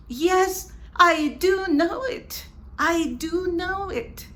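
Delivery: high, loud insistence on DO; emphatic affirmation. Shown as a demonstration. Teacher affirms against a doubter, high and emphatic on DO. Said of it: "to make an emphatic affirmation".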